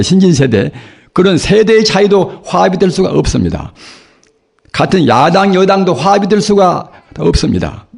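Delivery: normal talking voice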